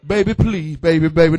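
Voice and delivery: hammed-up husband begging his wife, loud. Man begging his wife not to leave, loud mock pleading. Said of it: "before you start begging".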